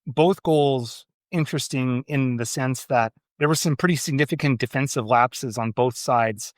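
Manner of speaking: normal talking voice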